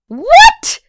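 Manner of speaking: very high, loud squawk of outrage; shrieky, indignant outburst. A narrator's reading. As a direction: squawk it in disbelief. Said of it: "Pat squawked".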